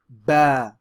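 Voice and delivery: Ba said loud and clear as a teacher model; teacher modelling Ba; Arabic letter Ba. Shown as a demonstration. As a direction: model Ba for students to repeat. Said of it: "Repeat after me again".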